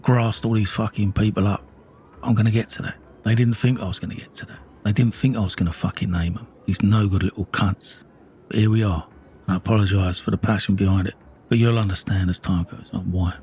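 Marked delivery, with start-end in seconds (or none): none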